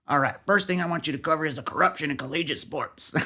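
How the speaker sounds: put-on rowdy country accent. News director giving a first assignment, rowdy country accent. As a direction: imitate a rowdy country boss. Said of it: "he had this rowdy country accent".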